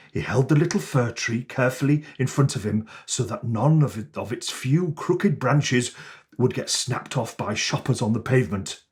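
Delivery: ordinary delivery, nothing marked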